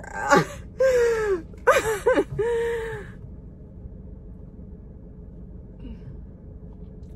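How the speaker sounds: high teary laugh; a laugh that breaks into crying; real laugh-cry; laughing through tears. Real, not acted. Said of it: "I wanna tear up right now".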